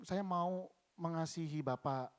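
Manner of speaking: normal talking voice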